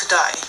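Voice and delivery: "to die" for "today"; Australian "today" with a long I vowel. Shown as a demonstration. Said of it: "pronounce the A in today as I".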